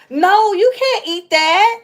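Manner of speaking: loud, high, drawn-out telling-off; urgent parental scolding. Real, not acted. Parent stopping a child from eating something, loud scolding.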